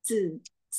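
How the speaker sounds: "S" here as a thick, unaspirated "dzeu"; thicker z sound. Shown as a demonstration. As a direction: thicken it into dzeu, no puff of air. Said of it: "lebih tebal". (bunyi "dzeu" yang lebih tebal)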